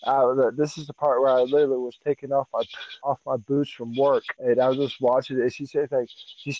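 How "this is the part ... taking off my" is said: ordinary delivery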